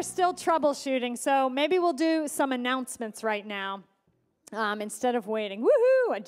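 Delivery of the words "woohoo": loud, high cheer; enthusiastic whoop. Real, not acted. Joe cheering on the announcements, loud whoop. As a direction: whoop to pump up the room. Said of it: "Joe, I appreciate the enthusiasm".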